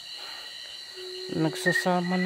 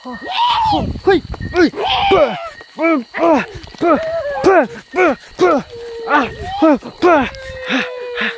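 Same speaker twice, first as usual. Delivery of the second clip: high loud terrified yelling; panicked screams; 12 semitones higher, 10 dB louder, slower than usual. Real, not acted. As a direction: scream as if being attacked. Attacked, screaming.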